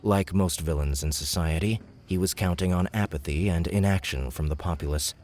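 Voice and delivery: ordinary delivery, nothing marked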